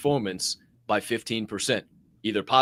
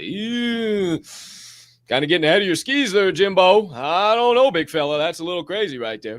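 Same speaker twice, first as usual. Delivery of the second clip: higher and louder, condescending doubter; mock friend doubting your plan, higher and louder; mocking naysayer; 7 semitones higher, 7 dB louder than usual. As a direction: talk down to him like a skeptical pal. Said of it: "They don't say".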